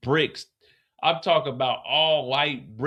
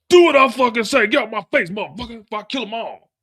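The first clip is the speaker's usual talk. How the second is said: much higher and louder angry shout; retold furious yell; 9 semitones higher, 8 dB louder than usual. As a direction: blow up at her like the boss. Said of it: "he goes off and tells her".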